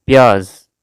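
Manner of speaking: ordinary talk, nothing marked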